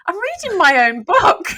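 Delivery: high, loud, giggly reply; retold gleeful admission. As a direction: re-enact owning up with loud amusement. Retold reply to husband, tittering.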